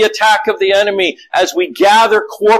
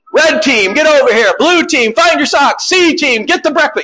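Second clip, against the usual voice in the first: barked-out orders pitched higher, hammed-up commander; mock team leader; 7 semitones higher than usual. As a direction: play a drill leader ordering the family. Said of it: "I'm like Navy seal blue team leader".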